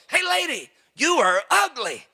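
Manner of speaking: high, squawky bird voice on "lady", dropping back for the insult; squawking parrot. Acted. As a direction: play a rude talking parrot mocking a passer-by. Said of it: "He goes".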